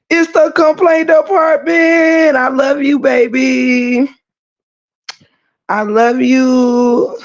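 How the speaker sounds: high, drawn-out singsong; playful gushing. Pretend. Host gushing love to complaint department, high singsong. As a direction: stretch it out high and sustained, mock-adoring.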